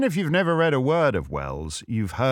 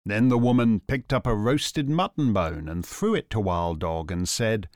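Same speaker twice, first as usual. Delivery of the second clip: ordinary delivery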